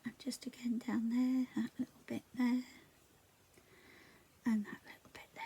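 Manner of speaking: soft whispered crafting; whispering. Real, not acted. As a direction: whisper it close to the mic. Whispers while colouring in.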